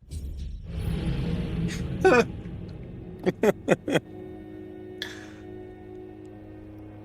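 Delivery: high burst of laughing. Real, not acted. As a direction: crack up at the twist. Laughs at death.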